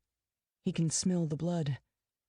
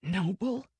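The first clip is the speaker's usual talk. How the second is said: astonished; 2 semitones higher than usual. A narrator's reading. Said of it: "capable of astonishment".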